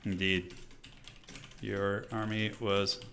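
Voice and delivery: soft, slow, distracted murmur; half-voiced while typing a message; absent-minded murmur. Real, not acted. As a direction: say it quietly to yourself, word by word. Typing a chat reply aloud.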